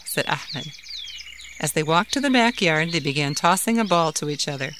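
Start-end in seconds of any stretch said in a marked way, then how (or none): none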